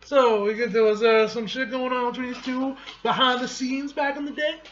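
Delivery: high, loud gossipy outburst; delighted, high-pitched exclaiming; excited reaction. Real, not acted. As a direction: blurt it out, thrilled by the gossip.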